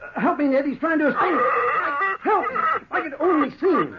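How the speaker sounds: panicked struggle; desperate call. Acted. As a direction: shout for help as it slips away. Doctor losing grip on creature.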